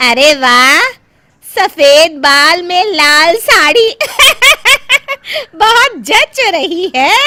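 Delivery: high, loud, slow jeering with laughter; mocking guests. Wedding guests laugh at the mother-in-law's red sari. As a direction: jeer through laughter at the old woman.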